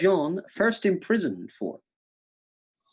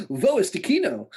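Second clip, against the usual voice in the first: playful schoolroom German question; 2 semitones higher than usual. Pretend. Host showing off GCSE German, playful German accent. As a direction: recite exam-learnt German. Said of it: "That's our G C S E German".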